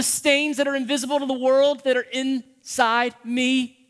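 high, loud, slow fervent prayer; fervent plea. Acted. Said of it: "David's going".

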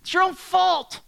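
loud, high desperate yell; yelling to God. Retold or imitated. Preacher shouting as if pacing outside, desperate yell to God. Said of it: "a place where I could yell".

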